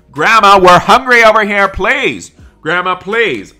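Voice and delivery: put-on hungry kid shouting at grandma; impatient calling. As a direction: yell across the cafeteria at grandma. The lunch line is stuck.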